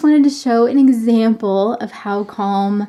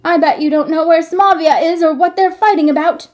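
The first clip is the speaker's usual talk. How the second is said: put-on higher, louder goading boy; taunting challenge; 8 semitones higher, 6 dB louder, faster than usual. Hunchback boy daring Marco to know Samavia, higher taunt. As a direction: throw the challenge at him. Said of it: "threw the words at him".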